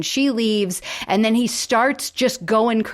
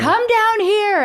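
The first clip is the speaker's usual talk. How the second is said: high, slow mock yell upward; retold yelling; 12 semitones higher, 5 dB louder, slower than usual. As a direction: drag out the call to her window. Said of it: "he's just yelling to her".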